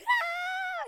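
high yelp of a whole crowd yelling; a short high yelp, like a shocked crowd; mock crowd yell. Vlogger imitating the crowd in the Oscars promo, a high yelp. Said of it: "everybody in the scene starts yelling".

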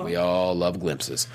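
mock deep voice. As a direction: drop into a mock-serious deep voice.

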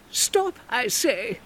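high, slow shouted command; Comtesse shouting. Acted. Comtesse shouting at Bernard to stop. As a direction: shout him down as the lady. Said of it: "the Comtesse shouted".

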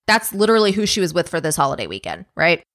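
ordinary talk, nothing marked

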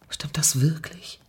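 leises, langsames, ungläubiges Flüstern. A narrator's reading. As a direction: zaghaft flüsternd nachfragen. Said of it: "flüsterte Niki". (leise und langsam gehaucht)

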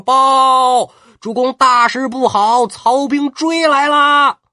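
high, loud, breathless messenger crying out; urgent soldier's report. Acted. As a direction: shout the alarm up to the lord. 探子急报军情. (探马高声急报)

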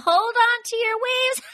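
high, slow, cackling yell. Retold or imitated. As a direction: shriek it out way up high. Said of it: "she yells out".

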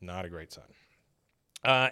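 normal talking voice

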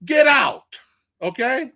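high, loud incredulous shout; hyped-up fan shout; excited shout. Real, not acted. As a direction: yell it in thrilled disbelief. Excited disbelief at trailer.

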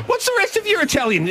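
high teasing jab. Real, not acted. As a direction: needle him with a grin. Teasing co-host about Italian.

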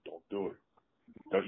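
hushed warning through still lips; lips not moving. Retold or imitated. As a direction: mutter it very softly, lips barely moving. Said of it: "you can't see my lips move".

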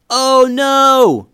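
high, very loud cry of alarm; panicked yell. A narrator's reading. Boy seeing a squirrel join the chase, panicked yell. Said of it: "yelled Eli".

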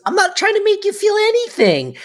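high put-on voice of an indignant mother; lightly hammed-up old lady denial; mock old mother. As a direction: play the martyred mother in falsetto. Retelling Sylvia's denial.

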